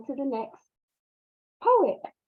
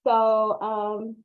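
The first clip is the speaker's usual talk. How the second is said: usual speaking voice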